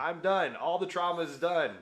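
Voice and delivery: slow, loud, high-pitched declaration; mock triumph. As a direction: proclaim it with hammed-up finality. Acts out believing all trauma ended.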